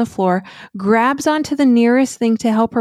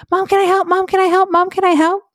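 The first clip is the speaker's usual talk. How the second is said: put-on high, eager kid voice, asked three times; begging child; 10 semitones higher, faster than usual. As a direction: imitate a little girl pleading to help. Kids begging to help bake.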